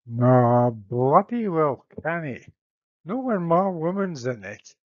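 a gruff Scottish lord standing firm. Acted. Menelaus in Scots.

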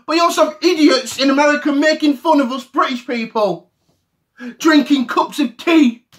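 ordinary delivery

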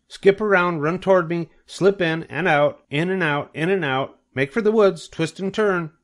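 high, urgent goose commands; crying out orders. A narrator's reading. Goose commanding cornered pig, high and urgent. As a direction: call out quick orders. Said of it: "began to give orders".

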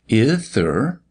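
"Ith, sir" with th in place of s; childish lisp. A narrator's reading. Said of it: "All that he lisped".

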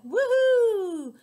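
very high, loud whoop; cheering. Acted. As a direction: whoop like a happy crowd. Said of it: "They're like".

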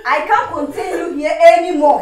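loud, heated shouting; furious eviction. Acted. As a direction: shout that they are finished here. Throwing Cindy out.

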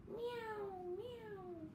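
high, soft mewing meow; cat meow. Shown as a demonstration. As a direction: mew like a little cat for the kids. Teacher imitates cat again.

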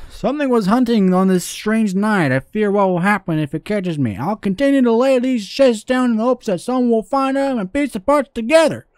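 high-pitched southern storytelling voice; southern note writer, fearful. Pretend. Gamer reading a worried fisherman's note, southern character voice. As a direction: read it as a hammed-up southern fisherman.

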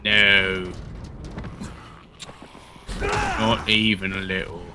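mock British accent, drawn out; playful put-on British accent. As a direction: take your time in a fake British accent. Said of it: "That's my British accent".

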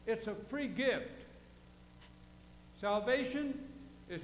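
preacher dictating into the margin; dictation pace. Shown as a demonstration. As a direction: spell it out so they can write it. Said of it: "write down".

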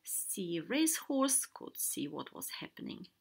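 plain reading voice

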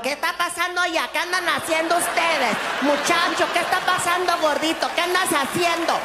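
high, rapid-fire Spanish; freaking-out housekeeper. Acted. Housekeeper questions laughing men in Spanish, freaking out. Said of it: "Housekeeping is freaking out".

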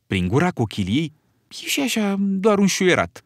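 ordinary delivery

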